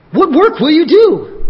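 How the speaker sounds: hammed-up challenge to Jesus; mock demanding crowd. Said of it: "they're asking for a sign".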